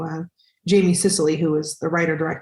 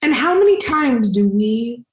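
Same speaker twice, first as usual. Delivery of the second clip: imitating Oprah; 7 semitones higher, slower than usual. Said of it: "She goes".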